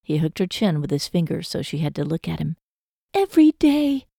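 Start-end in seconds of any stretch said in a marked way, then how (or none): 3.2-4.1 put-on high young woman's promise; Lizzie's voice. A narrator's reading.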